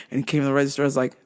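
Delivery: normal talking voice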